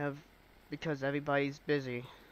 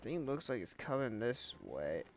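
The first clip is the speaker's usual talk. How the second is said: blocked nose, congested voice; talking through a stuffy nose; stuffed-up nose; 2 semitones lower, 9 dB quieter than usual. Real, not acted. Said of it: "I am so stuffed up right now".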